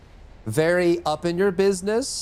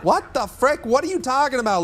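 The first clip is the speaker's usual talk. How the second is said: 1 incredulous; 7 semitones higher, 3 dB louder than usual. Real, not acted. Teammate annoyed at game.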